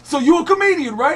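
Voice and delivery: loud, high comic impersonation; big imitated voice, high and loud; Tracy Morgan. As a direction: mimic a brash comedian, pitched well up. Acts out Tracy questioning him.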